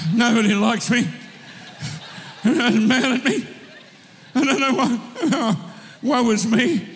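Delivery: hammed-up self-pitying whine, laughing through it; a childhood rhyme whined in mock misery. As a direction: play the sulky kid, half-laughing. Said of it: "we used to say that as kids".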